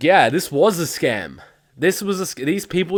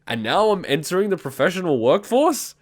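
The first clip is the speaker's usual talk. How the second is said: ordinary delivery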